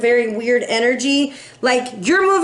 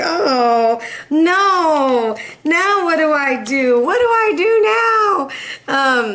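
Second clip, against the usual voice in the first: mock panic; 4 semitones higher, much slower than usual. To the Capricorn reader who got into trouble, mock panic rising to a wail.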